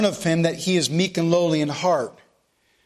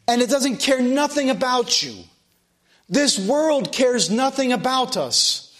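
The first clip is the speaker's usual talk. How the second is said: preacher pushing the point higher and harder; fervent preaching; 4 semitones higher than usual. Acted. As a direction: drive the sermon home to the congregation. Preaching rises in force.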